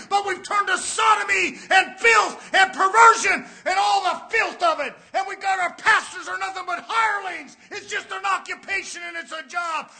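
loud denunciation from the pulpit, easing at the end; impassioned sermon delivery; forceful preaching. Real, not acted. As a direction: cry out against the nation's fall.

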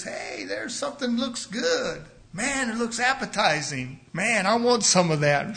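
lightly voiced eager little fish. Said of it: "That little fish says".

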